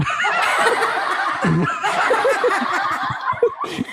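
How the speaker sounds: shrieking laugh, high pitched; high laughing burst. Real, not acted.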